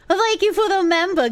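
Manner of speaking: high, put-on thank-you; hammed-up high-pitched gratitude; mock gushing thanks.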